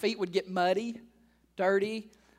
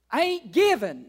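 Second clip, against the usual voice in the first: stingy church member; 6 semitones higher, 8 dB louder than usual. Retold or imitated.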